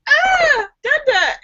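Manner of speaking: put-on very high crying baby "Ah, Dada". Baby upset between twin dads, high crying wail. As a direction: wail it like a confused toddler. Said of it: "he's like".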